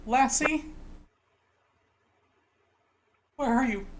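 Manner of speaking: put-on high-pitched little boy calling out. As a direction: call for a lost dog in a small boy's voice. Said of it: "you hear a little boy".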